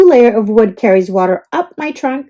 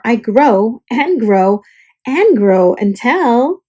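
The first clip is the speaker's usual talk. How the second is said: drawn-out growing; slower than usual. Acted. Growing slowly.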